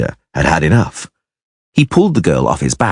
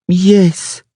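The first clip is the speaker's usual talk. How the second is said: put-on mumbling child; 13 semitones higher than usual. A narrator's reading. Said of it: "she mumbled".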